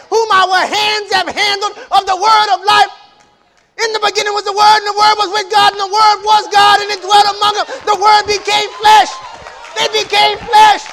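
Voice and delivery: high, shouted scripture proclamation; preacher at the climax, crying out each phrase; sustained loud pulpit shout; full-force preaching. Real, not acted.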